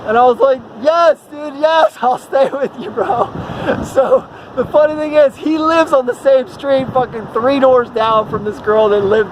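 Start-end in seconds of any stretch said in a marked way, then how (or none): 0.8-3.3 high, excited retold acceptance with a laugh; hammed-up relieved yes, breaking into laughter; laughing eager yes.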